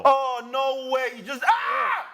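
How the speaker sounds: high, loud burst of disbelief; real stunned shout at the match; excited shout. Real, not acted. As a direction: yell it at the screen. Goal chance, watching the match.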